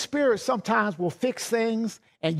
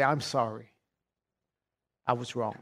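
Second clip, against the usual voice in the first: humble apology; 10 semitones lower, 7 dB quieter than usual. Pretend. Husband apologizing to wife.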